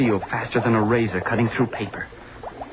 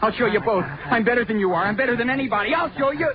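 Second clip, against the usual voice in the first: rising outburst; 9 semitones higher than usual. Acted. Driven mad by taunting voices.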